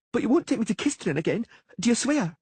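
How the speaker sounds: put-on high, pleading child demanding; girl making the Witcher swear; anxious princess. A narrator's reading.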